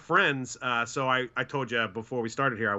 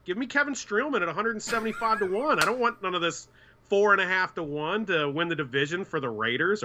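higher, insistent rant at the odds; emphatic refusal; 6 semitones higher than usual. Retold or imitated. To a friend pitching division odds, an insistent higher rant. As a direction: push it hard, wanting the long shot instead. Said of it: "I was like".

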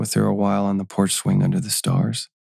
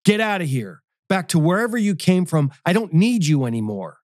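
high, raised furious order, lightly acted; infuriated; 9 semitones higher than usual. Enraged man ordering his guide away, infuriated. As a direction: drive her away in anger. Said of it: "infuriated by this abrupt truth".

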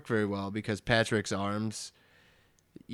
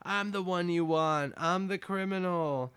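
mock calling after; 8 semitones higher than usual. SpongeBob runs out following the police, mock calling after. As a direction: imitate calling after someone driving away.

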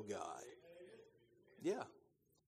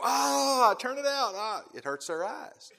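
high, wailing mock protest at the light; mock pained complaint; 9 semitones higher than usual. Preacher acts out people blinded by sudden light, mock pained complaint. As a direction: cry out as if dazzled, hammed up. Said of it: "No, they go".

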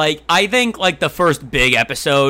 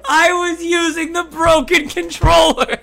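whiny high voice; 12 semitones higher than usual. Acted. Mock quarrel over PlayStation game.

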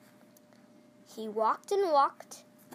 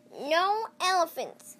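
firm refusal; 5 semitones higher, 7 dB louder, slower than usual. Acted. Grandma refuses again.

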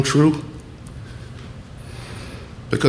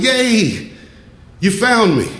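much higher, sudden fake glee; mock cheerful child-game voice; 11 semitones higher, slower than usual. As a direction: cover it up with a squeal of fun. Candy caught, faking cheerful game.

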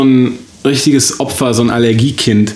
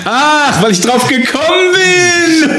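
mock outraged shout; slower than usual.

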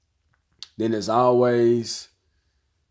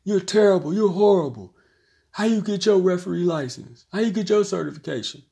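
taunting a referee in a put-on heckler's voice; mock parent heckling; 8 semitones higher than usual. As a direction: play an indignant parent berating the ref. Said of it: "a parent in the stands yell at him".

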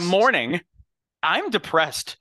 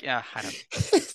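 imitating Toronto mans accent; slower than usual. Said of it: "and you're like".

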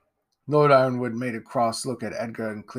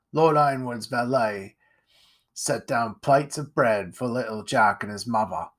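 usual speaking voice

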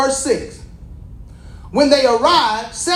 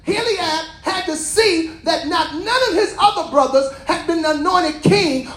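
full-force preaching; 8 semitones higher than usual. Acted. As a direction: drive the message home to the congregation.

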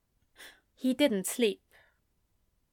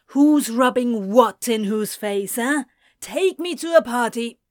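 confrontational taunt; 7 dB louder than usual. Acted. Anthony confronting Ezra, leaning in.

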